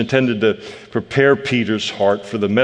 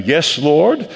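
willing; 6 semitones higher, 3 dB louder than usual. Shown as a demonstration. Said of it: "You can say".

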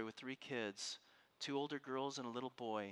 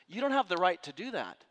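loud, higher, indignant; stern rebuke; 6 semitones higher, 10 dB louder than usual. Retold or imitated. Lawyer confronting the authorities, loud and indignant. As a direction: put them in their place. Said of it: "He points at her and he says".

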